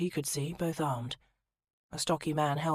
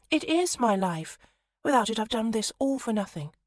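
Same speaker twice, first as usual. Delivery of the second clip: pleading; 6 semitones higher, 5 dB louder than usual. Acted. Minerva pleads for her trunk.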